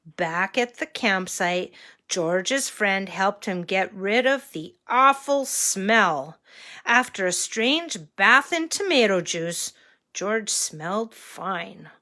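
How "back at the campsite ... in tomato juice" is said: ordinary delivery, nothing marked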